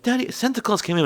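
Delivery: put-on high-pitched kid voice; excited child. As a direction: imitate a child announcing big news. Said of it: "And be like".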